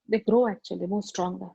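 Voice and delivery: normal talking voice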